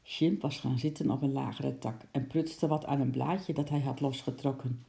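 plain reading voice

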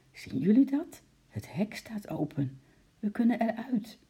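high, then a sudden whisper and high again; a little penguin plotting the escape; excited penguin voice. A narrator's reading. Penguin urging his friends at the open gate, high and secretive.

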